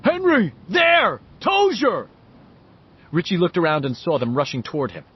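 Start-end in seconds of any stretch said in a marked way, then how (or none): 0.0-2.1 loud, high bellow. A narrator's reading. Victor spotting Richie and bellowing to Henry. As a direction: roar out each word to point him out. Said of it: "Victor bellowed".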